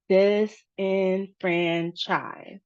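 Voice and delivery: very slow "disenfranchise", each syllable on its own beat; four separate syllables. Shown as a demonstration. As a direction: sound out "disenfranchise" piece by piece. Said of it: "disenfranchise has four".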